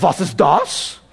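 German "Was ist das?" with a laugh; German speaker. Retold or imitated. Buddy answering in German, puzzled, half laughing. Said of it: "he's like".

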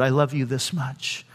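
low, soft voicing of God; quiet, grave declaration of love; hushed solemn answer. God's answer, pointing to the cross.

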